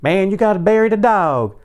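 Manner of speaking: very high-pitched neighbor caricature; neighbor guy. Retold or imitated. Neighbor asking about the dead dog, high caricatured voice. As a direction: put on a squealing high voice for the neighbor. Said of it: "He's like".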